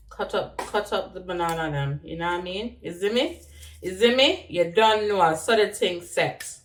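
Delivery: casual Jamaican Patois accent. Shown as a demonstration. Vlogger narrating banana cutting for laughs, patois accent. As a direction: chat through the chopping in Jamaican Patois. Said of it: "I'll talk patois".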